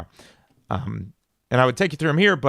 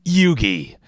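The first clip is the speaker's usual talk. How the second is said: Seto Kaiba voice; 4 dB louder than usual. Retold or imitated. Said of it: "doing my Seto Kaiba voice".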